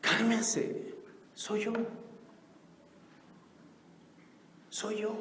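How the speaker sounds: soft, gentle calming words; calm reassurance. Acted. Jesus calming his terrified disciples, soft reassurance. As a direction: soothe frightened friends.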